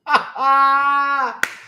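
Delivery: high, loud drawn-out whoop of laughter; excited cackle. Real, not acted. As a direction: burst out in one long high cry. Thrilled outburst at trailer.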